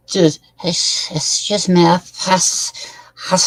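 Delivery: put-on slurring, wobbly drunk talking to a cop. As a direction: play a drunk insisting he's sober. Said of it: "I can play a very convincing drunk".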